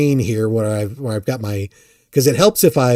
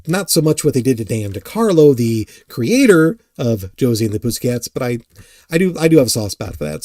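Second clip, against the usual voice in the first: ordinary delivery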